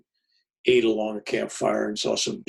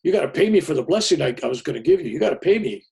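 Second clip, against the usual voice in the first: mock angry demand; 4 semitones higher, 8 dB louder, faster than usual. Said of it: "He was mad".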